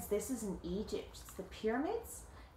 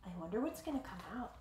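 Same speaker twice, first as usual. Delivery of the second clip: usual speaking voice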